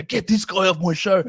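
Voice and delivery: mock dismissive. Podcaster mocking his rival guest, mock dismissive. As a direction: wave off the guest like a fake enemy. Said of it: "I go".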